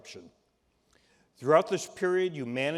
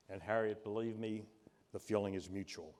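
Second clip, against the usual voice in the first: usual speaking voice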